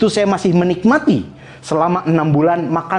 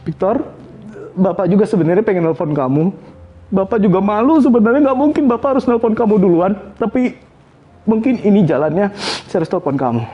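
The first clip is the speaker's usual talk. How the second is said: father apologizing to his son on the phone, tearful; father crying; 5 semitones higher than usual. Retold or imitated. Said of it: "tiba-tiba dia nangis juga".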